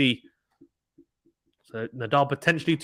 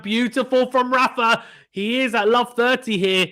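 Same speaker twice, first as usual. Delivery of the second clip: higher, brighter burst of praise; excited call; 7 semitones higher, 3 dB louder than usual. Real, not acted. Commentator hailing Rafa's winner, excited. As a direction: lift up for the great shot.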